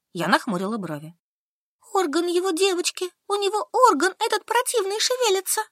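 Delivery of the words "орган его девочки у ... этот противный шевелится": put-on higher, stubborn young woman; Solka answering. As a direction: answer flatly, digging in. Solka frightened. (выше, упрямая Солька)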